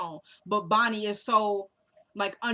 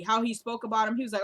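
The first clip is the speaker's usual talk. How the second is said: ordinary talk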